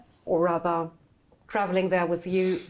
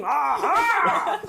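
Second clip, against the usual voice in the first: high, shouted heroic cry; boisterous shout breaking into laughter; Minsk's battle cry; 10 semitones higher, 4 dB louder than usual. Acted. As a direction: burst out with a hammed-up war whoop. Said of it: "there's Minsk going".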